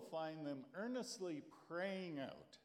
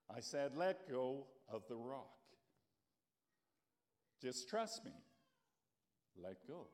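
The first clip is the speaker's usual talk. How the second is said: calm God; 2 semitones lower than usual. Acted. God speaks calmly to Jack.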